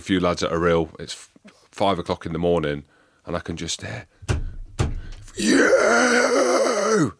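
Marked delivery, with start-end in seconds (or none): 5.4-7.1 sudden high shout of a furious coach; coach yelling. Retold or imitated. Coach yelling at players at five in the morning, shout. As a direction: bark it out across the hotel corridor. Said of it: "He's fucking going mad".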